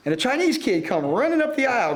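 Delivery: usual speaking voice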